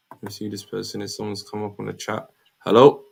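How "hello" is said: ordinary talk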